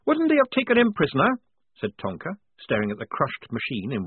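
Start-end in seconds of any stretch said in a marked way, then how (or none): 0.1-1.4 put-on high, doubtful young woman; Tonka's voice. A narrator's reading. Tonka doubting Polly's story, high and sceptical. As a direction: question it sceptically.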